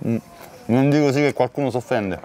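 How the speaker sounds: chewing pizza while talking; talking between bites; mouth full. Real, not acted. Seen 3: Blue-shirt man bites pizza at -1s, keeps it at mouth while talking.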